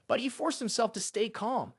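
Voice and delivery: plain reading voice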